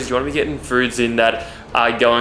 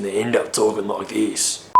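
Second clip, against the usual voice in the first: softer, lower Viking movie voice; Viking accent; 4 semitones lower, 5 dB quieter than usual. Shown as a demonstration. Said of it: "I couldn't work out how the Viking speaks".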